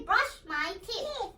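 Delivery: slow, clear model phrase for the class to repeat. Shown as a demonstration. Teacher modelling a phrase slowly for the children to repeat. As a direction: lay out Brush my teeth slowly. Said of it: "Everybody say".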